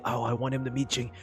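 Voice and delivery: ordinary talk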